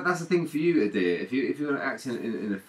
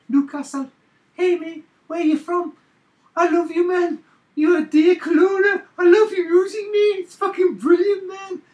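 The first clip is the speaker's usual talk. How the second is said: very high, loud Geordie caricature; Newcastle accent; 8 dB louder than usual. Shown as a demonstration. Friend showing off how Newcastle people talk, squealed Geordie accent. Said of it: "It's like that".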